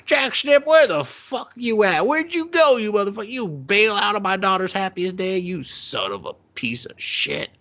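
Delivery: high, hammed-up screaming father; mock furious rant. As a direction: yell like a betrayed dad.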